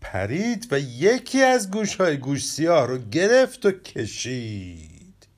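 lively children's story reading, characters lightly voiced; expressive storybook narration with playful character voices. A narrator's reading. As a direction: tell it like a bedtime tale for kids. Reads the story aloud.

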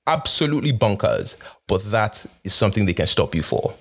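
normal talking voice

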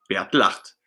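usual speaking voice

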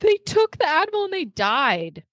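excited. Real, not acted. Dramatic retelling, pitch jump.

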